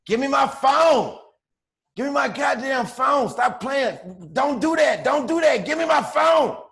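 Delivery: high, loud frantic yelling for a phone; desperate shouting from across the room; yelling in the background. Retold or imitated. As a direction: shout it like she's fighting to grab it back. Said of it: "all I hear in the back is".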